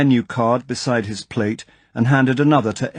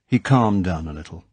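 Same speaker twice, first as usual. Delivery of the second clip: ordinary delivery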